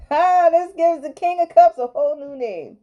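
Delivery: high, loud exclamation; delighted outburst. Real, not acted. Reader reveals the good card, delighted outburst. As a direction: crow it with gleeful surprise.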